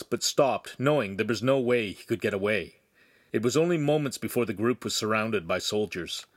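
usual speaking voice

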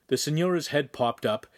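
ordinary delivery

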